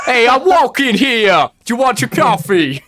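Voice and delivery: over-the-top New York cabby shout at a pedestrian. Pretend. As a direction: belt it out like a hammed-up Brooklyn taxi driver. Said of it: "Do a New Yorker, cabby".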